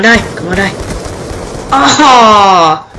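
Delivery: normal talking voice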